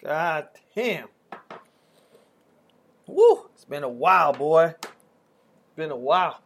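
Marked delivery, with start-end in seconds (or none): 3.1-3.5 short, loud, high whoop. Real, not acted. Friend reacting to a shot, high whoop. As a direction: let out one sharp whoop.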